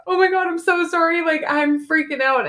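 high-pitched, hurried mock panic; flustered, rushed apology in a high voice; retold frantic apology.

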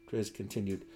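ordinary delivery

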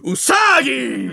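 drawn-out high name call; hammed-up contestant announcement; mock announcer. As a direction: stretch the name like a big reveal. Announces contestant as opening caption.

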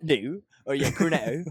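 lightly put-on West Country accent. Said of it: "It's very Hot Fuzz".

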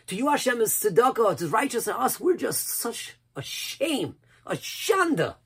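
high, impassioned mock lament; acted-out self-reproach. Preacher mimicking what we think it means, high lamenting cry. Acting out the mistaken common reading.

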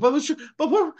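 very high, loud, stammering plea; mock pleading. Nephew begs his uncle, mock panic. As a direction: squeal it out as a hammed-up protest.

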